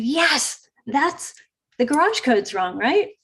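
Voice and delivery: excited. Retold or imitated. Excited agreement with agent.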